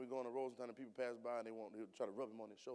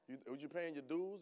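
ordinary delivery

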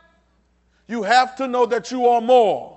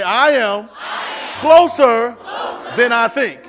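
shouted call; 4 semitones higher, 8 dB louder, slower than usual. Shown as a demonstration.

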